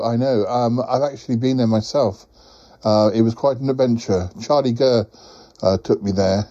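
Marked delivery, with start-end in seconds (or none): none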